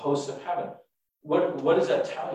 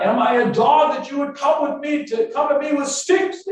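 high, loud indignant challenge; calling out; 12 semitones higher, 6 dB louder than usual. Acted. Goliath calls out to David, loud and indignant. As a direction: call it out as an outraged taunt. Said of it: "he's calling out".

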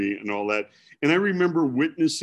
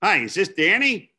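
put-on Milwaukee accent; 7 semitones higher, 4 dB louder than usual. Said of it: "I hear this Milwaukee accent on there".

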